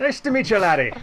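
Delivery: the project manager greeting Jack in a high Scottish voice. Acted. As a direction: hail the newcomer like a jolly Scot. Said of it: "I haven't had that much scotch yet".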